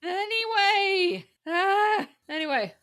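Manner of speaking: slow, high, loud stretched anyway; drawn-out anyway. Real, not acted. Moving the podcast along after a bad joke, drawn-out anyway. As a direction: stretch it to shut the topic.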